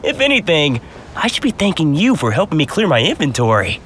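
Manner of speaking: ordinary talk, nothing marked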